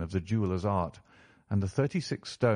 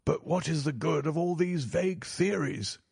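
higher-pitched banker, impatient; exasperated banker in a raised pitch; impatient cry; 8 semitones higher than usual. A narrator's reading. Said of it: "cried the banker impatiently".

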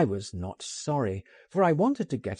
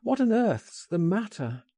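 alarmed cry; 8 semitones higher than usual. Acted. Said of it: "I cried".

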